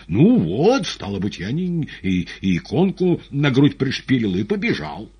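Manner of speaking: high, halting excited account; agitated patient. Acted. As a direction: stumble through it, loud and worked up. Agitated patient hurries through his account.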